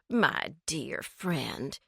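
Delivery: put-on soft, slow baby-talk coo. A narrator's reading. Brunette cooing at Twix lady like a baby. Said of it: "she cooed as if talking to a baby".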